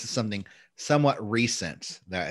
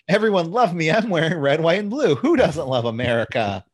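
loud, higher gloating boast; mocking braggart; 7 semitones higher, 6 dB louder than usual. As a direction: ham up a smug patriot. Mocking wrestler's patriot persona.

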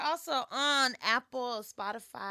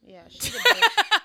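laughing; 12 semitones higher, 16 dB louder, slower than usual. Real, not acted. Teasing agreement, laughing.

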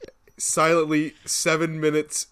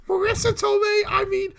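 very high squealed mock voice; strained squeak, drawn out at the end; Tom Holland impression. Co-host mimicking the actor slipping up on Aunt May, squeaky impression. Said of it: "he just sounds like this".

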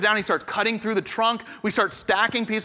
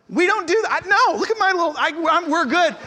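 mock defensive protest; 10 semitones higher than usual. Mock brushing off help.

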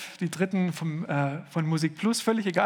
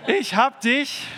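higher, louder, slow lead line; mock sluggish call; 7 semitones higher, 6 dB louder, slower than usual. Shown as a demonstration. Pastor prompting the congregation, loud and drawn out. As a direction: call it out like a tired song leader at the heat. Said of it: "das ist jetzt die dreißig Grad Version".

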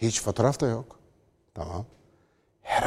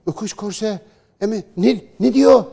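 baffled voice rising higher, ending in a loud high squeak; mock confused critic; 8 semitones higher, 5 dB louder than usual. Commentator mocking a critic who googles the words, baffled and rising. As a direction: play a lost man puzzling over old Turkish words. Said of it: "Google'a yaz şimdi böyle yazacak".

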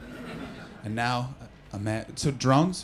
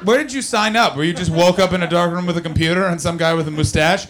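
usual speaking voice

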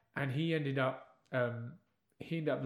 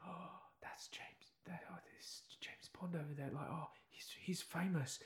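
put-on starstruck boy whisper; 2 semitones higher, 9 dB quieter than usual. Boy secretly tells his dad about a star, awestruck whisper. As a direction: whisper it like a shy young fan.

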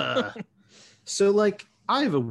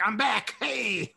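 high cheery Connor voice; 10 semitones higher, slower than usual. Retold or imitated. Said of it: "Connor's like".

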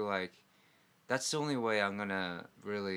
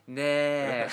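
louder crisp "네" impression; uniform Korean yes; 5 semitones higher, 10 dB louder than usual. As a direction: clip a crowd-style "네". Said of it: "like, uniformly".

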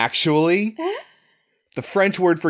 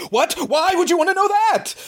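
much higher, a hammed-up suspicious townsperson; mock suspicious local. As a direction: sneer like a shifty local hiding something.

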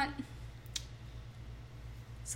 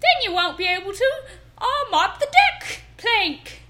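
higher, louder hammed-up stage pirate; pirate accent; 5 semitones higher, 4 dB louder than usual. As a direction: play the salty pirate hawking vitamins. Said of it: "I'm so good at accents".

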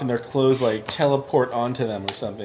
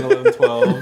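jumps high and loud on the last numbers; squeaky climax; 4 dB louder than usual. Real, not acted. Gamer listing endless sequels, squealing at the end.

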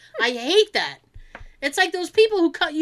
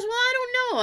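high hedging; 12 semitones higher than usual. Retold or imitated. Retold receptionist hedging, high voice.

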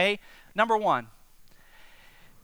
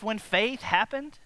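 ordinary talk, nothing marked

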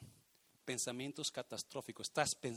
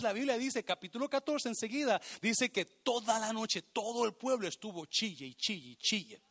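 usual speaking voice

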